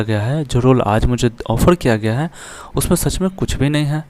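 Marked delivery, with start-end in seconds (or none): none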